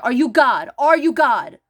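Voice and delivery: loud, insistent confrontation; angry challenge. Real, not acted. Challenging a parent's claim to authority, angrily insistent.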